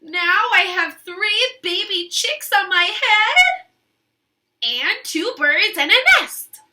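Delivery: Piggy happily telling the count; cheerful Piggy. Acted. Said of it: "said Piggy".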